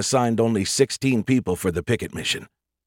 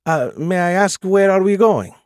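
put-on Italian accent; 10 semitones higher, 5 dB louder than usual. A narrator's reading. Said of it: "thick Italian accent".

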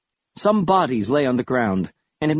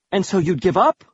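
higher, challenging question; a mildly indignant challenge, pitched up; demanding; 6 semitones higher, 3 dB louder than usual. A narrator's reading. Narrator confronting exhausted soldiers, demanding. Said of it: "I demanded".

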